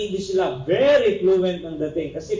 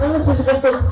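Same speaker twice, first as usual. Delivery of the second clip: "nagsisikantot" flung back loud and high, laughing; shocked boss; 6 semitones higher, 5 dB louder, faster than usual. Retold or imitated. Boss hearing the employee's odd word, shocked and loud. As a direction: boss repeats "nagsisikantot" in loud, raised disbelief. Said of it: "Ano, ano?".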